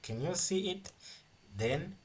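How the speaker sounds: normal talking voice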